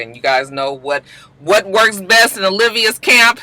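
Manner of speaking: ordinary talk, nothing marked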